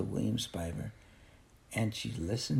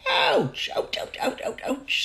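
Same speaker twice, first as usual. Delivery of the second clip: pained yelps. Acted. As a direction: yelp at the sting. Mother stung by alcohol on scratches.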